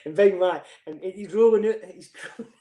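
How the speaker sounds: ordinary talk, nothing marked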